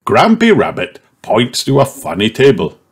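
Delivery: ordinary delivery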